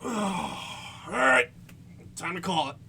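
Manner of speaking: loud, weary call to end; loud announcement. Retold or imitated. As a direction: announce it's over, loud. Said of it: "everyone suddenly goes".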